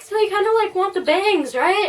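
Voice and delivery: high-pitched imitation of a perky stylist; cheery hairdresser. Hairdresser checking what the client wants, cheery. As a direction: put on a high, chirpy checking voice. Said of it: "she seemed overly cheery".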